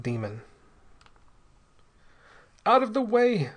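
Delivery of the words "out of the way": high, loud cry of despair; terrible despair. A narrator's reading. Man warning others off as his body runs mad, despairing cry. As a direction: cry out desperately, pitched way up. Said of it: "in a voice of terrible despair".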